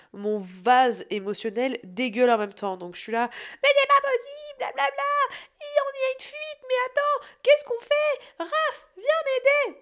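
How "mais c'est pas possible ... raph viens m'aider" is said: very high, loud panicked cries; frantic high-pitched panic, calling Raph; panicked shrieking. Retold or imitated. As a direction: shriek for help as the water floods. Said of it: "Je crie de colère".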